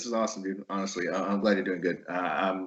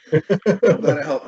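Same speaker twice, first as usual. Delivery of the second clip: higher, slow words through laughter; real laughing; 14 semitones higher, 5 dB louder, much slower than usual. Real, not acted. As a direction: laugh your way through the line. Laughing after learning he helped.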